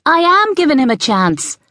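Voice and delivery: put-on boy calling back in a high voice; cheery calling back. A narrator's reading. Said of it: "Mark called back cheerily".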